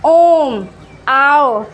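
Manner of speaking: ओम and आओ held long and loud; prolonged vowel demo. Shown as a demonstration. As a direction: stretch it out like calling someone far off. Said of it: "जैसे". (ओम, आओ खूब लंबा खींचकर)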